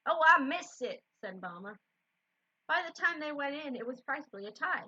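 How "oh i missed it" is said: put-on Southern accent. A narrator's reading. Mama missing a shooting star, Southern accent. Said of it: "just gonna go with a Southern one".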